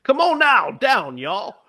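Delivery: loud, high mock Cajun accent. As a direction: imitate a Cajun drawl, loudly. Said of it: "speaks with a Cajun accent".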